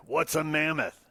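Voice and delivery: normal talking voice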